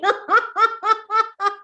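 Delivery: high rolling laugh; high, rhythmic exercise laugh; demonstrated laugh. Shown as a demonstration. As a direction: laugh it off in a long run.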